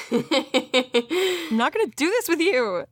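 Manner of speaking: slow it down and say it like a threat; slow mock stern refusal.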